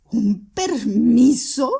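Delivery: repite permiso con asombro furioso, más alto; lánzalo lenta e incrédula; exclamación indignada. A narrator's reading. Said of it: "exclamó Catalina Ivanovna". (Catalina repite indignada la palabra permiso)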